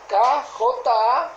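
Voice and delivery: ordinary delivery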